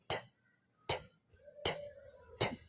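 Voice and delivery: "T" crisp and aspirated; t sound demo. Shown as a demonstration. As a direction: flick "t" off the gum ridge. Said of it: "sound like this".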